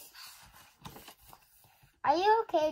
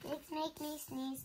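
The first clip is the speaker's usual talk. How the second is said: plain reading voice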